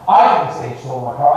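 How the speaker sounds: "I" stressed at the start, the rest falling away. Shown as a demonstration. Coach demonstrating intonation to pitchers, stress on "I". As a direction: put the emphasis squarely on "I". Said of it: "say this sentence a few times".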